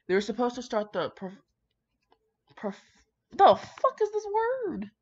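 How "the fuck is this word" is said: high, loud baffled cry; stunned by a strange word; baffled outburst. Real, not acted. Reader stumbling on an unknown word, high baffled outburst.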